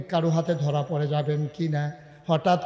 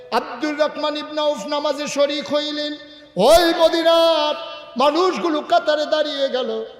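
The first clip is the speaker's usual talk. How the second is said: high, ringing sermon narration; impassioned preaching; 10 semitones higher, 6 dB louder than usual. Real, not acted. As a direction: lift the voice high for the crowd. Preaching at full force.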